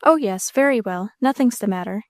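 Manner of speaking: plain reading voice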